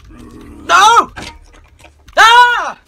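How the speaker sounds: high, loud terrified scream; screaming in fear. Real, not acted. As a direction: scream as if caught. Chased in horror game.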